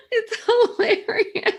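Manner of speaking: high, helpless laugh into words. Real, not acted. Laughing with a friend over a funny scene, high. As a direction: crack up and squeak it out.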